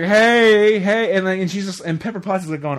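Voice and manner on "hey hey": higher, louder party whoop; loud mock drunk party hollering; mock drunk shout. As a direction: call it out drunk and rowdy. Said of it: "It's like".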